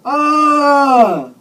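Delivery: long, loud high scream of pain. A narrator's reading. Patient screams as the man falls on him, loud scream. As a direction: scream out at full force, very high. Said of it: "and I screamed".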